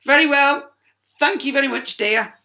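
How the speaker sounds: high, loud gracious voice; polite character. Acted. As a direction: thank them sweetly.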